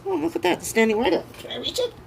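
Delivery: squealing high, thrilled at the cards; giddy excitement. Real, not acted. Reader to Aquarius viewers, thrilled by a card standing up. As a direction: let the pitch jump up, delighted. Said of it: "I don't usually get this excited".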